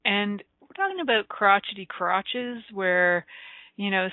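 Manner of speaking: ordinary talk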